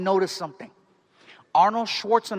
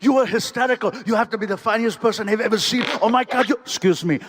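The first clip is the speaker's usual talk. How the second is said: put-on effusive Austrian-accented star; gush, then switch abruptly to greeting others; Arnold Schwarzenegger; 2 semitones higher than usual. Star praising the comedian then moving on, Austrian impression.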